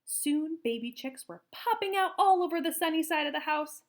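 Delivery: plain reading voice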